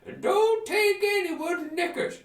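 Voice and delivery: put-on high, loud doddering old hermit; daft hermit. Hermit answering the adventurer, high loud batty. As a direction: rattle off silly wisdom like a batty sage. Hermit gives nonsense advice.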